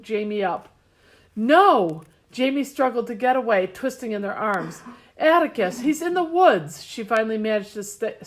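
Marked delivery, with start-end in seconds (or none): 1.4-2.0 loud, desperate no; shouting no. A narrator's reading. Struggling to get away. 5.2-6.8 high, loud raspy plea. A narrator's reading. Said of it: "say in a raspy voice".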